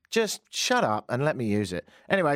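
usual speaking voice

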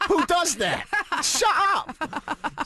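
high-pitched mock exasperation through laughter; annoyed at himself. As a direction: berate yourself comically, voice shooting up. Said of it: "I started annoying myself".

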